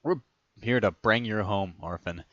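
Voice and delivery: ordinary delivery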